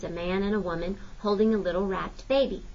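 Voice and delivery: usual speaking voice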